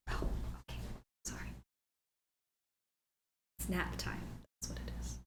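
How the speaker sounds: whispered so the dog can sleep. Real, not acted. Owner whispering as her dog naps, hushed. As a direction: whisper softly, nap time.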